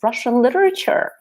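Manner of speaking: high, appalled teacher's protest; horrified teacher. Retold or imitated. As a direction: act out the shocked music teacher. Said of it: "She was like".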